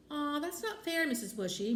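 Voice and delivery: put-on high-pitched child's grumble, falling back; complaining. A narrator's reading. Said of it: "Max complained".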